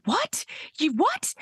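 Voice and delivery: retold high-pitched disbelieving question; incredulous. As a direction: imitate a stunned dad, voice shooting up. Husband's shocked reply to lost child.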